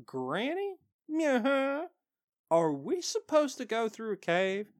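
put-on high, questioning child character; little pony. Young pony asking granny about the cave, high and hesitant. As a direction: pitch it up like an uneasy kid.

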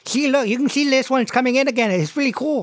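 put-on high-pitched lisping little boy; proud kid showing off a gap in his teeth. Boy showing a new tooth coming in, gap-toothed kid. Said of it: "he's missing his two front teeth".

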